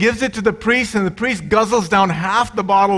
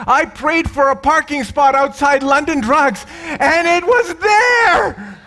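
high, excited cry of a thrilled churchgoer; excited shout; 8 semitones higher than usual. Retold or imitated. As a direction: blurt it out like you just saw a miracle. Diane rushing up excited.

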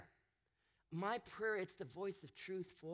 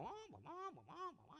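much higher, thin soft wah wah noises; nagging wah-wah; 10 semitones higher, 10 dB quieter, much faster than usual. Retold or imitated. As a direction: mimic droning chatter like a muted trumpet. Mimicking someone's droning talk.

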